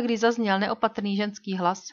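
ordinary delivery

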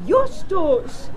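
aunt calling her nephew from a distance. Acted. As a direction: shout his name to summon him. Aunt calls him from afar.

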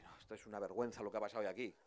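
voz muy baja, grave y rápida; portavoz del PP desganado. Retold or imitated. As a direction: imita a un portavoz sin fuerza, casi entre dientes. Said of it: "sale el portavoz de". (portavoz tímido, muy bajito y deprisa)

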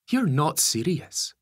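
slow, stunned woman; shocked disbelief. A narrator's reading. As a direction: let the disbelief sink in. Lisa shocked.

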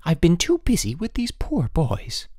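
high-pitched, reproachful wife; cold reproach. A narrator's reading. Wife too busy with poor boys, cold reproach. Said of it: "she said coldly".

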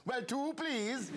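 slow, high Welsh lilt; Welsh accent. Retold or imitated. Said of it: "instinctively, I just went".

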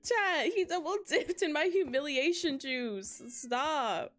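laughing. Real, not acted. Dad keeps correcting her.

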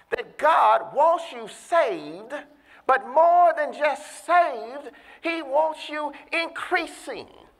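slow, high preacher's climax; drawn-out fervent sermon delivery; preaching at full force. Acted.

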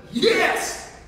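a high, loud burst of relief; retold delighted yes; overjoyed yes. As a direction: shout it like the best news ever. Thrilled to get a diagnosis.

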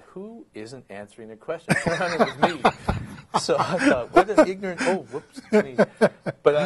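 high, loud laughing through words. Real, not acted. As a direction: laugh while telling it. Laughing at his own story.

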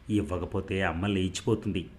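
ordinary delivery, nothing marked

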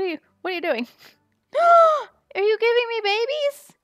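very high, loud, thrilled squealing; overwhelmed excitement. Real, not acted. Streamer given a baby pet and dress, squealing with joy. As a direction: squeal in disbelief at the generosity. Receives gifts in game.

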